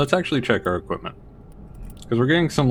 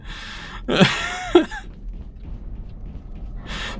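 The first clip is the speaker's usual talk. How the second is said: squealing laugh; 6 dB quieter than usual. Real, not acted. Breaking down at game.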